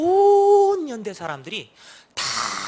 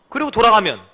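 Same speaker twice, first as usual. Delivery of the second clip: ordinary talk, nothing marked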